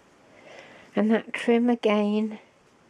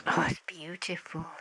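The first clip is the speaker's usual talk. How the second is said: soft breathless whisper of wonder; awed whisper; 8 dB quieter than usual. Real, not acted. Crafter marvelling at a pearl-centred flower, whispering. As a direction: whisper it as if not to disturb it.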